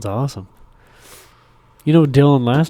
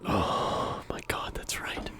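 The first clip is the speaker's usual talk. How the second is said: whisper; 15 dB quieter, much slower than usual. Real, not acted.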